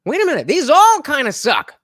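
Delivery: high, loud sudden realization; dawning realization. Retold or imitated. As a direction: catch on all at once. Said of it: "just like".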